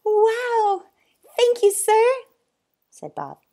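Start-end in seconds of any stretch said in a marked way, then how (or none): 0.0-2.0 much higher, loud delight; boy thanking the zoo owner; thrilled boy. A narrator's reading. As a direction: burst out with joy.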